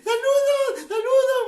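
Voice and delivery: high, loud hello; loud greeting. Real, not acted. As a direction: shout the greeting out. Greeting viewers loudly.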